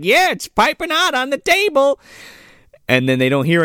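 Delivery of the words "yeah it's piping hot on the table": high, singsong; pitched-up playalong; mock Missus Claus. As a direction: chirp it up high. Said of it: "Missus Claus is like".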